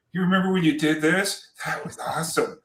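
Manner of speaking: excited fan gushing, pitch jumps up; gushing starstruck fan, goofy; Chris Farley. Retold or imitated. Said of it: "the old Chris Farley".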